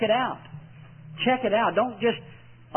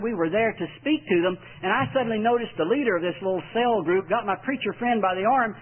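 ordinary talk, nothing marked

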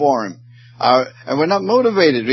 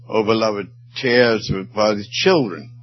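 normal talking voice

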